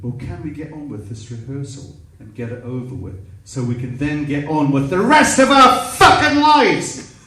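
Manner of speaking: controlled start rising to high yell; building to a shout. Retold or imitated. Ralph demanding they get on with rehearsal, building to a shout. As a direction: build steadily into an exasperated roar. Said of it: "He shouted at me".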